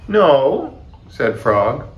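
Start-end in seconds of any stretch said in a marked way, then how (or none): 0.1-0.8 high, loud little answer; small voice. A narrator's reading. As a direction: answer as a shrinking frog, pitched up. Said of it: "peeped Frog".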